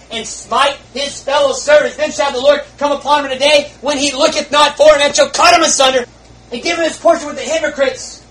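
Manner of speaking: loud, high thundering judgment; preaching at full force. Acted. Preacher warning the congregation of judgment, loud and high. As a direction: build to a roaring warning.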